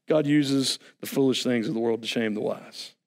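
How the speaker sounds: ordinary talk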